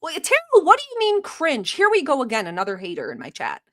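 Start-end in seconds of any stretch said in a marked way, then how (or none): none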